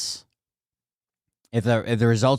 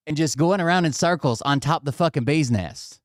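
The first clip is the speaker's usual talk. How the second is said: normal talking voice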